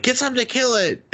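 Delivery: high-pitched, hurried mock panic; squeaky rushed retelling of an order; urgent mock order. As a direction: cry it out high and fast, imitating alarm. Retold urgent order in film recap.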